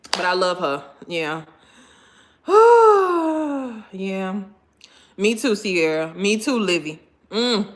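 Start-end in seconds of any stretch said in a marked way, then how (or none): 2.5-3.9 high, falling sigh; loud sigh. Real, not acted. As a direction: let out a big, drawn-out sigh. Sighs after recalling the demonstration.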